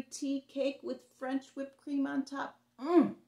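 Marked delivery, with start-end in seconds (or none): 2.8-3.2 higher, louder yummy hum; a delighted taste-test mm; savoring mm. A narrator's reading. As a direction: reader savoring the cake with a pleased hum. Savoring the strawberry tea cake.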